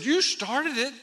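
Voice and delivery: high, loud comic blame; mock accusing. Brother blaming preacher outside the meeting, mock accusing. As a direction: throw the blame back loudly.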